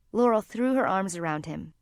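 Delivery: usual speaking voice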